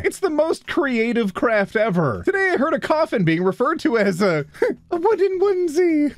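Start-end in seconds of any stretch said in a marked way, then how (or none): 4.9-6.2 high-pitched mock Irish accent. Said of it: "I f***ing love Irish slang".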